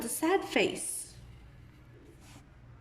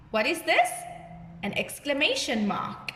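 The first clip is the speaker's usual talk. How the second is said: normal talking voice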